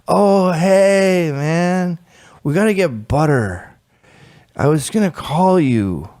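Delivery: airy high junkie drawl; a light take on a dazed junkie; spaced-out junkie. Retold or imitated. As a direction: play the oblivious stoner host. Junkie friend voiced in story.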